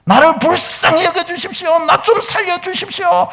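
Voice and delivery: high, straining plea for mercy; acted high-pitched calling out; crying out. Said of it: "그렇게 외친 거예요".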